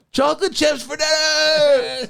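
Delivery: very high, loud, slow showman call; hype announcement. Retold or imitated. Dad imagining announcing chocolate chips to kids. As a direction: announce it like a prize.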